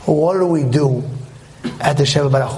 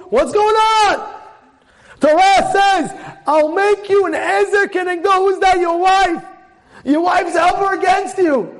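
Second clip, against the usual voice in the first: baffled outcry; 14 semitones higher than usual. Real, not acted. Preacher challenging listeners on the verse, crying out baffled.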